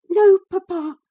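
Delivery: put-on high, breathless young girl; gasped, high girl voice. A narrator's reading. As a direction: gasp it out, frightened and high. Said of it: "she gasped".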